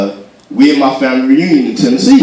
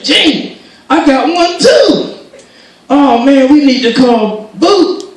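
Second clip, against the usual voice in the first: high-pitched, drawn-out delight; excited dad; 12 semitones higher, 4 dB louder, slower than usual. Retold or imitated. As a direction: call out to your wife, thrilled. Dad excitedly tells his wife.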